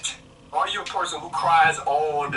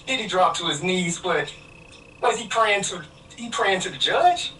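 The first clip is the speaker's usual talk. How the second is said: heated; 7 semitones higher than usual. Real, not acted. Caller argues heatedly about the verdict.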